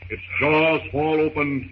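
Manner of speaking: usual speaking voice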